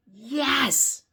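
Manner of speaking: high, loud, ringing "yes"; excited exclamation. Acted. Piggy saying it with an exclamation point, loud excited cry. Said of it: "there's a lot of excitement in what's being said".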